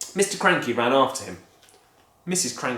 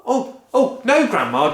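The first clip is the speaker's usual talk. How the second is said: higher, loud, protesting; alarmed boy; 10 semitones higher, 7 dB louder than usual. Acted. George protesting to Grandma, alarmed. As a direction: stop her in a panic. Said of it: "George said".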